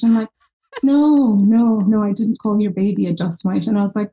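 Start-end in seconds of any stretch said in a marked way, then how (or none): 0.8-3.7 backpedalling in mock alarm, then settling; retold protest. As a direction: act out a hasty denial. Hasty denial in retold misunderstanding.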